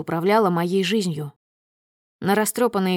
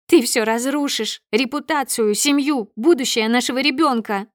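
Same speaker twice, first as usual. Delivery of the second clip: high, raised, frantic protest; agitated accusation; 5 semitones higher, 4 dB louder than usual. A narrator's reading. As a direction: plead angrily to save the family. Wife desperately accuses husband of ruining everything. (отчаянно и гневно, высоким голосом)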